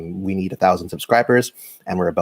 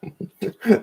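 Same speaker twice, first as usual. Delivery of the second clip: short high laugh; 13 semitones higher than usual. Real, not acted. Amused at chewing.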